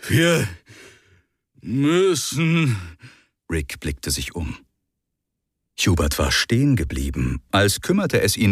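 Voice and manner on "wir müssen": drowsy, stretched words. Acted. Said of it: "Seine nächsten Worte dehnten sich".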